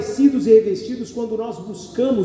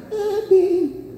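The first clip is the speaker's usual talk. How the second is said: higher, simpering little sigh; meek sly wife; 8 semitones higher than usual. Retold or imitated. Preacher mimicking the sly wife watching trouble, high simpering voice. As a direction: play the mousy wife shrugging off disaster. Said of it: "Essa é a sonsa".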